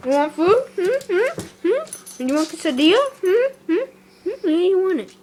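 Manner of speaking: high sing-up questions to a dog; teasing pet talk. Real, not acted. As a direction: tempt the dog with food, pitched well up.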